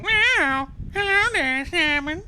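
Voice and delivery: high squeaky kitty greeting; kitten voice. Pretend. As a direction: greet him like a cute kitten. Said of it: "the fucking cat voice".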